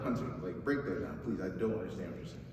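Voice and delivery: low, soft, quick mumbled plea; puzzled listener aside. Acted. Imagined listener asking the preacher to explain, low puzzled aside. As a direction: toss it off quietly as a confused listener.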